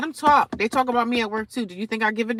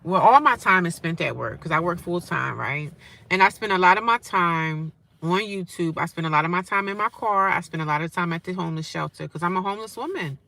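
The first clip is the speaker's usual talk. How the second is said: ordinary talk, nothing marked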